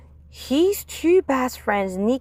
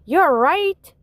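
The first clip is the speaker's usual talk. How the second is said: higher, louder warm agreement; teacher voice; 8 semitones higher, 5 dB louder than usual. Acted. Teacher agreeing with Stuart, higher and louder. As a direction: agree brightly.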